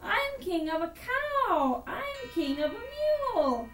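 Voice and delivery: high, swinging self-important turtle monarch; boastful turtle king. Acted. Yertle admires his own kingdom, boastful and high. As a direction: gloat grandly, stretching the big words.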